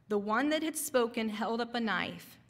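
ordinary talk, nothing marked

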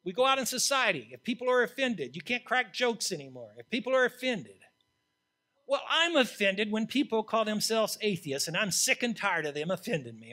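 loud, high, fervent preaching; preaching at full force. Acted. Preacher to the congregation about sin, preaching at full force. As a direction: thunder it from the pulpit. Preaches Isaiah's cry and beyond.

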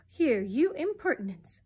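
loud, drawn-out scolding; cross. A narrator's reading. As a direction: tell off a cheeky bird, crossly. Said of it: "said Mary Poppins crossly".

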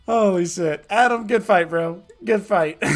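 loud, high hype thanks to a donor; excited shout-out. Real, not acted. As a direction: call out the thanks still buzzing from the fight. Thanking a donor after the fight.

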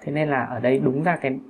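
normal talking voice

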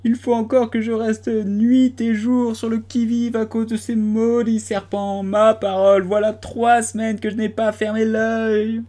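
high, plaintive lament; lamenting pigeon. A narrator's reading. Pigeon complaining about guarding eggs, high lament. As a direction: moan about the hardship.

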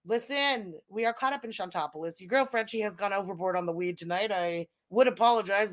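put-on Coronation Street accent. Streamer confessing to viewers, mock Coronation Street accent. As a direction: declaim in a fake British soap accent. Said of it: "it's Coronation Street".

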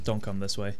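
ordinary delivery

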